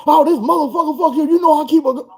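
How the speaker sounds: high-pitched imitated cussing; hammed-up furious girlfriend; mock rant. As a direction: squeal it like she's going off. Girlfriend threatens with gun.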